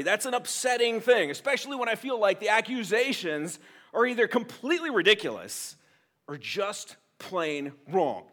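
ordinary talk

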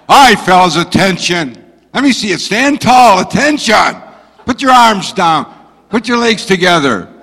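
barking orders at the recruits; firm drill instructor voice; drill sergeant commands. Pretend. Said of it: "What would a drill sergeant say to them".

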